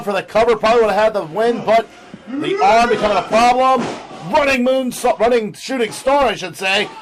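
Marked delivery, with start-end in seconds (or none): 2.3-6.3 high rising play-by-play; excited call. Real, not acted. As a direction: climb with the action. Live call of high move.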